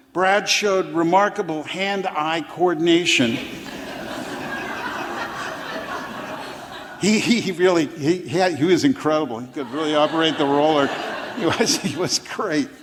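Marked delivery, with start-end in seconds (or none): 3.4-6.9 a soft real laugh under the breath; amused silent chuckle; breathy laugh. Real, not acted. Reader laughs at own line. 7.0-12.7 talking through real laughter; giggling admiration; laughing. Real, not acted. Laughing while praising Brad.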